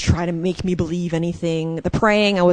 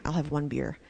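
a softer, self-persuading murmur; quiet mock rationalizing; 3 semitones lower, 12 dB quieter than usual. Drinking alone, talking herself into one more, softly. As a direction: sneak it past yourself in a low voice. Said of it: "it was just classic".

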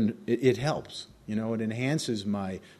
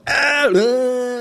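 long high, loud groan; mock anguished wail; 12 semitones higher, 9 dB louder than usual. Man acting out how hard that news hit him, mock wail. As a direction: cry out in exaggerated protest. Acts out his frustrated reaction.

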